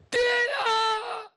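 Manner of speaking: high wailing cry for father; a sudden tearful high wail. Acted. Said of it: "娘娘一边走一边眼泪就下来". (高声哭喊)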